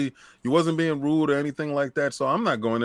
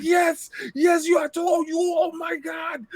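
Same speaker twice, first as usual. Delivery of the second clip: imitating Boom's excitement; slower than usual. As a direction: shriek it like the best news of his life. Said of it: "He's like".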